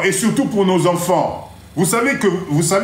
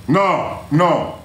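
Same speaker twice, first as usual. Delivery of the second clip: normal talking voice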